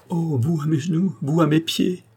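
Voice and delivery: high, overwhelmed exclamation; stunned disbelief. A narrator's reading. Andrée seeing the count kneel before her, stunned disbelief. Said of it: "comme une femme qui ne peut croire".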